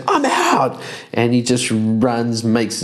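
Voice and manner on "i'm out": high quick cop-out; high bailing Ymir. Acted. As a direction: squeak it and run. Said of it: "Ymir just goes".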